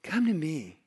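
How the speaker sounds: quiet, inviting voice of God; gentle invitation. Retold or imitated. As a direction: tenderly call them closer. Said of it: "God says".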